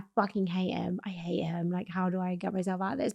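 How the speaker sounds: low, hushed self-talk; hushed retold resentment; whispered inner thought. Retold private thought while driving.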